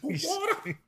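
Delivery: squeaky high falsetto Water?; mock squeal. Mocking the answer.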